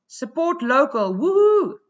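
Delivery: Support local read out, then woohoo in a high loud whoop; mock cheer. Sheep from the cartoon cheering for his own river, mock whoop. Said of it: "this guy says".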